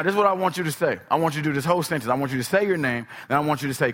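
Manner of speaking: ordinary talk, nothing marked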